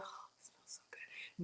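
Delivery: very soft, savoring whisper; whispered delight. Real, not acted. Cook sniffing the sesame oil, whispered delight. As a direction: whisper it while inhaling a lovely aroma.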